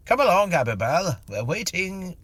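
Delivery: high, loud mocking call; withering sarcasm. Mister Tilly mocking the medium's fake Abibel, withering sarcasm. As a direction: taunt her fake spirit scornfully. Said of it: "with withering sarcasm".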